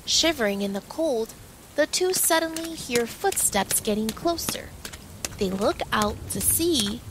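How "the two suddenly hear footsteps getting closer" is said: ordinary delivery, nothing marked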